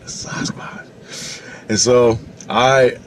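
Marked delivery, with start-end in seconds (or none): 0.1-1.4 hushed, voiceless wonder; awed whisper. Retold or imitated. Kid stunned by the uncle's sighting, awed whisper. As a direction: drop to a whisper of retold amazement.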